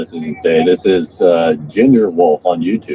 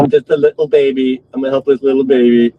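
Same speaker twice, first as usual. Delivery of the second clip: put-on baby voice; 6 semitones higher than usual. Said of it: "you talked like this".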